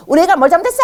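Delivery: high, loud protest at the teacher; angry parent. Retold or imitated. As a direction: demand it like an indignant parent. (선생님에게 따지는 높고 큰 항의)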